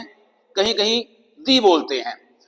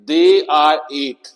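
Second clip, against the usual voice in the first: very slow, careful reading of an example; slow model sentence; 4 dB louder, much slower than usual. Shown as a demonstration. Teacher slowly reading an example sentence aloud. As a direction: read it slowly for students to copy. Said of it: "कुछ sentence देख सकते हैं".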